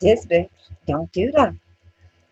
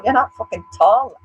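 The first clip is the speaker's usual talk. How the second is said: ordinary talk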